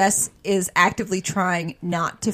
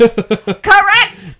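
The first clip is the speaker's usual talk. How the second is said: loud high laughing exclamation; laughing shout; 12 semitones higher, 8 dB louder than usual. Real, not acted. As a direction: laugh it out triumphantly. Laughing confession of her type.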